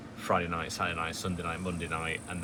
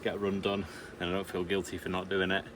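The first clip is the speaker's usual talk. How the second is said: usual speaking voice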